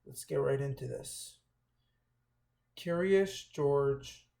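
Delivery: usual speaking voice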